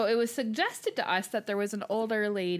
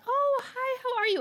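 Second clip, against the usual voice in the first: high, sing-song hello; chirpy greeting. Retold or imitated. Retelling how she greeted the young man, a high chirpy hello. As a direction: greet him brightly, pitched way up.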